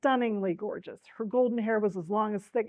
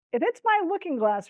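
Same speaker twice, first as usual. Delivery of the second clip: raised, higher threatening call; mother's sharp cry; 7 semitones higher, 5 dB louder than usual. A narrator's reading. Queen threatening her daughter over her looking glass, crying out. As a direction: cry out a warning, pitched up and louder. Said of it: "her mother cried".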